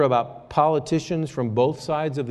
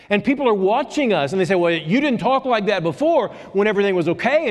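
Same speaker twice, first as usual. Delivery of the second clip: raised, fervent preaching voice; 6 semitones higher, 4 dB louder than usual. Acted. Pastor exhorting his congregation, fervent preaching. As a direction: preach it out to the church.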